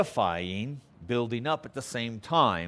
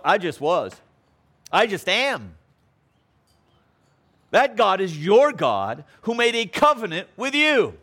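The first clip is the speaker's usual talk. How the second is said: higher, louder preaching climax; rising pulpit fervor; fervent preaching; 8 semitones higher, 6 dB louder than usual. Acted. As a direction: lift the congregation with each phrase. Preaching builds to full force.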